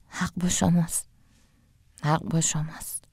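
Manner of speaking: quiet, tearful, broken voice; voice trembling from tears. A narrator's reading. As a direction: say it softly, fighting back tears.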